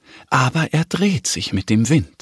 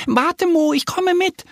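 very high, squeaky duck call; little duck calling out. A narrator's reading. Little duck calls after the bear running off, eager call. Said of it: "ruft Annie".

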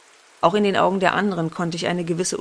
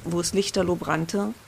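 usual speaking voice

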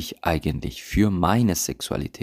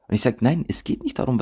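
ordinary talk

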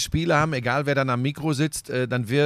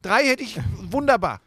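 higher, louder mock indignation; mock outrage; 7 semitones higher, 4 dB louder than usual. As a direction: tease him with fake outrage. Teasing co-host about long crying. (gespielte Empörung)

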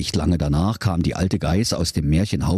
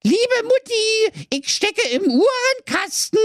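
tiny voice. A narrator's reading. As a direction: call out small and thin. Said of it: "da rief eine feine Stimme".

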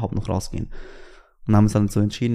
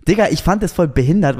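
higher and louder indignation; outraged outburst; 8 semitones higher, 6 dB louder than usual. Real, not acted. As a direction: blurt out the outrage. Outraged recalling the call.

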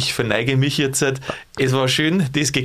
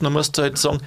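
ordinary talk

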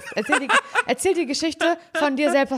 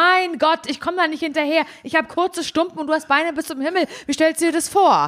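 higher-pitched playful rant; mock exasperated; 5 semitones higher than usual. Short-legged woman complaining to long-legged friend, mock exasperated. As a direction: re-enact a jokey complaint about short legs. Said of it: "Das hab ich so zu Simon gesagt".

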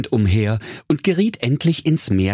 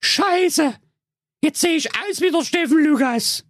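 put-on much higher cheeky young girl voice; Rapunzel. Rapunzel mocking the witch, high-pitched girl voice. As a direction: play Rapunzel high and sassy. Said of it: "sah in den Spiegel und erschrak".

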